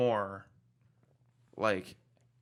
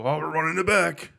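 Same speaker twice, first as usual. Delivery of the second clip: mock player; 4 semitones higher, 9 dB louder than usual. Mocking cornhole players.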